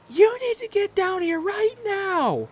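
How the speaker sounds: imitating his panicked wife in a high, slow falsetto. Wife calling in panic, retold.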